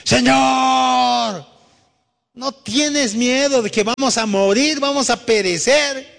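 high desperate shout of terror; panicked cry. Acted. Disciples shouting to the sleeping Lord in panic. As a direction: scream for help as the boat sinks.

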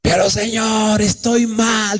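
high, whining plea to the Lord; hammed-up high protest; protesting sick woman. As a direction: imitate a woman wailing back at God. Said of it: "le contestaba".

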